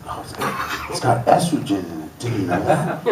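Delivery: lightly voiced soft know-it-all; know-it-all guy. A guy warning Joffrey about soy milk, lightly voiced and hushed. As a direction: hush it like a secret warning. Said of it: "He's like".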